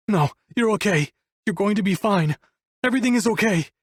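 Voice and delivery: high-pitched, frantic denial; desperate reassurance. A narrator's reading. Wolf tells lifeless Abigail she is okay, desperate. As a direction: insist she is fine, pitch far up. Man in denial over unresponsive lover.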